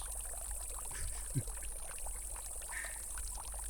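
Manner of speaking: quiet airy chuckle; hushed amused laugh; soft breathy laugh. Acted. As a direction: laugh softly under your breath. Amused by sleepy moth.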